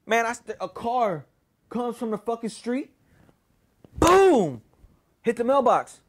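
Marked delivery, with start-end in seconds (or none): 4.0-4.6 loud, high vocal crash; imitated crash. Storyteller mimics a car hitting the mailbox, explosive imitation. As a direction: burst out with the impact sound.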